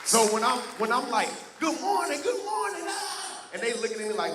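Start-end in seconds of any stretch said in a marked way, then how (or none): 1.6-3.5 high, bright, bursting good morning; overexcited greeting. Retold or imitated. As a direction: greet the kids way too hyped up. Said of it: "Whoa, calm down".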